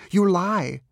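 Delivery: high, impassioned tirade; worked-up, zealous dog voice; frenzied devotion. A narrator's reading. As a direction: cry it out fervently, high-pitched. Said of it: "a frenzy of devotion".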